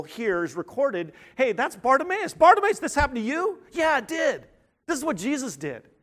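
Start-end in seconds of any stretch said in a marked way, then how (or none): 1.3-3.6 high, eager acted questioning; animated, much higher pitch, a bit louder; excited onlooker. Acting out excited onlookers questioning Bartimaeus. 3.7-5.9 excited confirmation. Acted. Acting out Bartimaeus confirming his healing.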